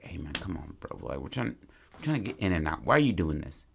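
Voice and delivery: mild hushed warning to back off; hushed brother pleading. Retold or imitated. Older brother quietly urges Oliver to stop, hushed. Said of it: "His brother's like".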